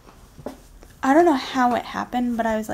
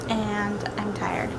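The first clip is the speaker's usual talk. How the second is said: tired whisper; 3 semitones lower, 6 dB quieter, much slower than usual. Real, not acted. Tired at midnight, unvoiced.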